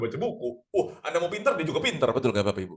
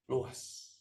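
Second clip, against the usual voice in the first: "Luas" breathed as a soft whisper; whispered echo; 11 dB quieter than usual. Shown as a demonstration. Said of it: "Luas jadinya". ("Luas" diucapkan berbisik)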